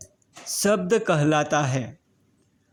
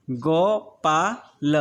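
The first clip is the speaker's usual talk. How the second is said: slow blended word; much slower than usual. Shown as a demonstration. Teacher to pupils, slowly blending the word. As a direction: draw out Gopal slowly, sound by sound.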